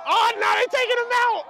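high, loud shout at the field; excited shout. Real, not acted. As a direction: yell it out in disbelief.

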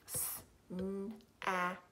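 "Snap" as four spaced sounds, s, n, a, p; sounding out. Shown as a demonstration. Teacher tapping out sounds for pupils, "Snap" segmented. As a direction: segment "Snap" softly, one sound at a time.

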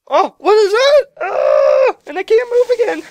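very high, loud shrieking; panicked scream. Real, not acted. Gamer attacked while unable to move, panicked shrieking.

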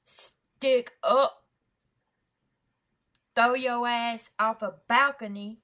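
acted tough shout; mad and dirty. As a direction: punch it out loud like a stick-up. Acting out getting mad and dirty.

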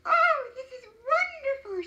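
high, loud exclamation of delight; very excited. A narrator's reading. Wife exclaims over the wishes, very excited. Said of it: "She became very excited".

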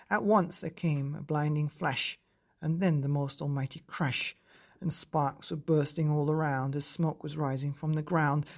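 ordinary delivery, nothing marked

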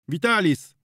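high, loud call to Witalis. Acted. Prokop calling Witalis over, loud high call. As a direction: call across the yard. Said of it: "zawołał Prokop".

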